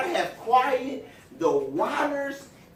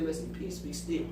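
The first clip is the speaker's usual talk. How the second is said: softer, lower hushed command to the storm; calm command; 5 semitones lower, 9 dB quieter than usual. Retold or imitated. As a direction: still the storm gently, lower and soft. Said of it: "he already stepped in before that and said".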